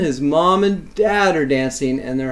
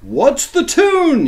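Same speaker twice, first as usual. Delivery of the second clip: high, loud, slow cow's yell; 9 dB louder, much slower than usual. Acted. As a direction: call it out across the barnyard. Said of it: "yelled the cow".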